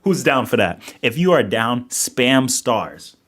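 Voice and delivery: loud, hyped-up streamer delivery; rally the chat behind a big idea; excited pitch. Real, not acted. Hypes a new stream idea to chat.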